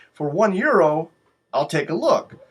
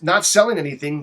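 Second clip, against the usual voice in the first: usual speaking voice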